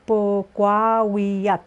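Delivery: Pogwawiak slowly, each syllable apart; slow teacher model of Pogwawiak. Shown as a demonstration. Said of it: "syllable by syllable".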